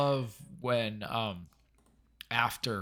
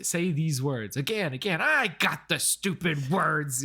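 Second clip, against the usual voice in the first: higher, louder imitated yell; mock exasperated yell; 5 semitones higher, 4 dB louder than usual. Quoting a hero yelling at people he thinks stupid, mock yell. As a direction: draw it out as an irritated mock yell. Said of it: "yelling at them".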